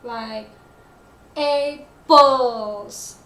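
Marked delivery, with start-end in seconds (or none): 1.3-3.2 APPLES with a loud, drawn-out second syllable; long second syllable. Shown as a demonstration. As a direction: stretch and punch the end of APPLES. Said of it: "very long rather than the first".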